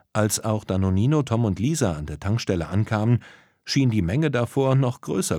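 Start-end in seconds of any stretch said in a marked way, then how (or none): none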